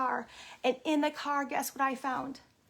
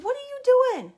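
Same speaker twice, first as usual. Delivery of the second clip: loud, high-pitched telling-off; exasperated scolding; 12 semitones higher, 10 dB louder than usual. Retold or imitated. Visitor catching kids off their letter, exasperated scolding.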